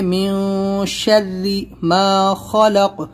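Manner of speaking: slow, with a clear خ in خلق and a bouncing ق; tajweed demo. Shown as a demonstration. Teacher demonstrates من شر ما خلق slowly. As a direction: sound the خ in خلق from the throat, end with a qalqalah on ق. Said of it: "خا بھی نکالنا ہے اور قاف پہ قلقلہ".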